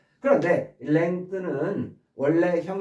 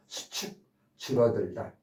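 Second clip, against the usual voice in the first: normal talking voice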